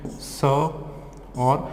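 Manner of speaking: drawn-out syllables; slow phonics sounding; sounded out slowly. Shown as a demonstration. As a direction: stretch each sound apart for the class. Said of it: "देखो कैसे पढ़ते हैं".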